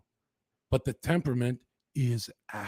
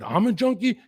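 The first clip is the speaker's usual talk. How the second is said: high, louder outraged question; indignant disbelief; 10 semitones higher, 5 dB louder than usual. Retold or imitated. Retelling his brother's insult, a high outraged question. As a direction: throw the word back, stung. Shocked at brother calling him junkie.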